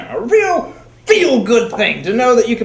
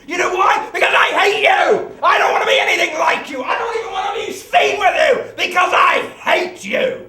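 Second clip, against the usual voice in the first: furious shouting; 7 semitones higher than usual. Acted. Son screams at father.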